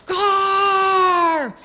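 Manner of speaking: high, drawn-out holler; long alarmed yell; lookout's warning shout. Acted. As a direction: warn the whole gang from afar. Lookout warning of returning parents.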